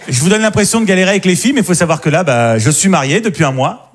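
usual speaking voice

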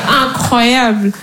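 very slow stunned aside. Real, not acted. Comedian reacting to her mother's jab, slow stunned. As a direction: drag it out in disbelief.

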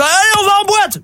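high, far rowdy shout; rowdy call. Acted. Rugby players calling the group to the club, high and rowdy. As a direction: bellow it like a drunk rugby player.

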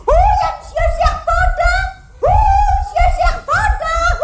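high-pitched excited holler; whooping, high shouted hello; shouting in greeting. Acted. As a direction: call out wildly to someone far away. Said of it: "criez pas comme ça".

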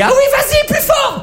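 hammed-up squealing lover.

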